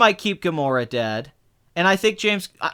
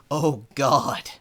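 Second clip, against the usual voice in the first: Captain America; 2 semitones lower than usual. Retold or imitated. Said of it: "something about the delivery of it".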